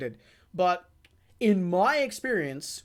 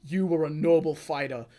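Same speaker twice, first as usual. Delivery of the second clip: grave respect for a fallen foe; Wamu honoring; 5 semitones lower, 4 dB quieter than usual. Retold or imitated. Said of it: "he's like".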